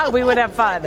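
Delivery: laughing through the words, amused. Real, not acted. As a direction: chuckle it out, delighted by the compliment. Laughing reply to a friendly compliment.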